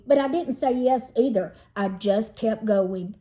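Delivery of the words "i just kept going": ordinary delivery, nothing marked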